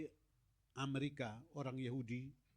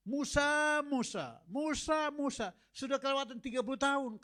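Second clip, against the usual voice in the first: booming, much higher and louder call of God; God calling Moses; 9 semitones higher, 6 dB louder than usual. Acted. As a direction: call Moses down from heaven, rebuking. (suara Tuhan memanggil Musa dengan menggelegar dan menegur)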